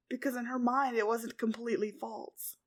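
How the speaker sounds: ordinary delivery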